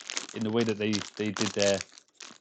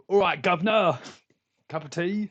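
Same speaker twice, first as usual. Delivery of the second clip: mock Cockney; 7 semitones higher than usual. Streamer greets American chat with cockney banter. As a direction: play the cheeky Cockney for the chat.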